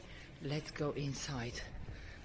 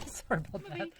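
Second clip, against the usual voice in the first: usual speaking voice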